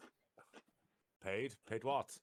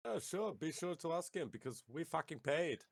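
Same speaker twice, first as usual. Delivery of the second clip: mocking echo; 8 semitones higher than usual. Player mocking back at Derek.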